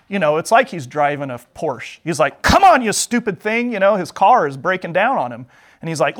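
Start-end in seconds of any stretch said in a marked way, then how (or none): 2.4-3.7 high, raised-voice impatience; acted frustrated outburst; exasperated rider. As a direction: curse at a stalled car, playing it up. Voices Balaam at balky donkey.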